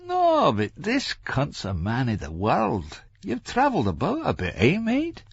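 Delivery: high, teasing Scots quizzing; mischievous Larry. A narrator's reading. Larry quizzes Franklin about his travels, mischievous. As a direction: coax with a mischievous smile. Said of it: "Larry quizzed, a mischievous smile on his lips".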